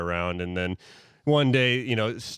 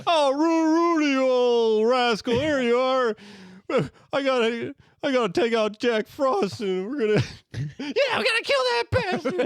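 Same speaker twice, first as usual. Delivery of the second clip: excited Santa. Acted. Santa greeting Rudolph.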